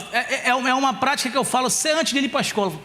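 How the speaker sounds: ordinary talk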